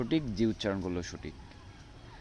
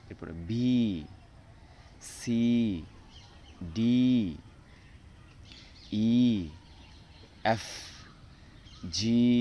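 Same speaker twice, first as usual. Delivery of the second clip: reciting the alphabet; slower than usual. Shown as a demonstration. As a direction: drill the letters one by one, clearly. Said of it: "আমি অ্যালফাবেটগুলো পড়ি".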